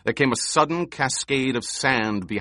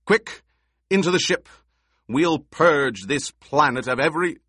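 high, loud urgent command; urgent shout; 5 semitones higher, 4 dB louder than usual. A narrator's reading. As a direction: hurry them aboard. Emperor urges escape.

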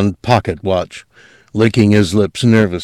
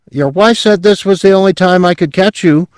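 high fast eager salesman pitch; bright eager young salesman, fast and high; 11 semitones higher, 4 dB louder than usual. Acted. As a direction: rattle off the sales opener. Salesman insists.

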